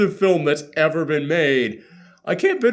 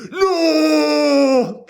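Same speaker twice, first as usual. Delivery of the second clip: hammed-up wailing scream, higher and loud; mock anguished scream; 10 semitones higher, 3 dB louder than usual. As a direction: overact a tragic cry. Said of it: "anyone screams in this movie".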